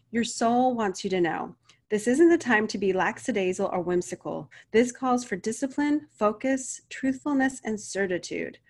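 reads the card's message aloud like an inspired reading; oracle card reading. Acted. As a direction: deliver the card's guidance as a gentle, earnest proclamation.

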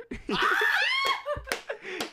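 high, loud cackle; loud laughing burst. Real, not acted.

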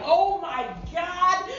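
shocked reaction. Retold or imitated. Retelling Jed's reaction to the bags of ashes, mock shocked. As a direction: mimic shock, stretching the words out.

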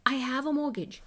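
usual speaking voice

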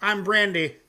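usual speaking voice

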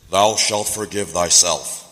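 low, loud, slow solemn decree; mock commandment. Preacher quoting a command the Bible lacks, mock solemn commandment.

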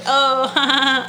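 slow, high mock laugh; imitated laugh. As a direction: laugh it off awkwardly. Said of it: "she starts laughing at herself".

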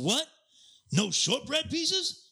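high, drawn-out outraged demand; irate customer. Retold or imitated. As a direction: mimic an indignant customer, pitch shooting up. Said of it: "He's all".

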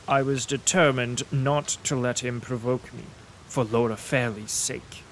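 ordinary delivery

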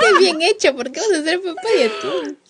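bursting out laughing, high and loud. Real, not acted. Laughing at her friend's tuna stuffed potato. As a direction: laugh through the words in disbelief.

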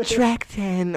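high, loud cheesy tag shout; corny producer tag. Pretend. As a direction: hype it like a corny beat tag. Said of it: "I don't wanna corny ass sound like".

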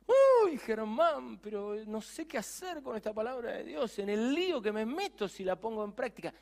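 high, anxious voice of a doubting believer; worried believer. Acted. A doubting believer pleads with pastor Germán, high and anxious.

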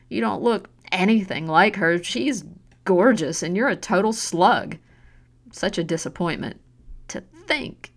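cruel scorn. Acted. Mother telling her daughter she's a disappointment, scornful. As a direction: cut her down piece by piece.

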